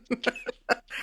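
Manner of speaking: airy unvoiced giggle; breathy laugh. Real, not acted. Laughing at the Robert Davi line. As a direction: let a soft breathless laugh slip out. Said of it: "Come on, you didn't laugh?".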